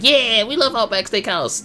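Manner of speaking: high, drawn-out cheer; mock crowd cheer. Crowd answering the wrestler's steakhouse line, high mock cheering. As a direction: cheer like a whole arena.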